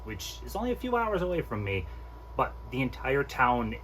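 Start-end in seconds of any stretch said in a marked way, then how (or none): none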